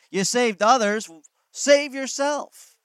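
high, loud, slowly drawn-out jeer; mocking taunt. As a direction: stretch the taunt out cruelly. Preacher acts out the mockers.

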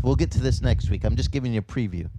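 normal talking voice

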